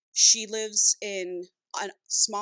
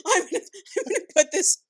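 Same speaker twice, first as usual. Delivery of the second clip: high, breaking up with laughter; laughing hard; 10 semitones higher, 3 dB louder than usual. Real, not acted. Reader admitting this is her online review, laughing hard. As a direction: try to talk through a real laughing fit. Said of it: "I'm laughing so hard".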